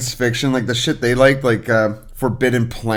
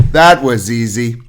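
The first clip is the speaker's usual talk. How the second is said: loud canned catchphrase from a desk button; Staples Easy Button; 6 dB louder than usual. Acted. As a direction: imitate a recorded button announcement, punchy. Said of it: "the Staples easy button".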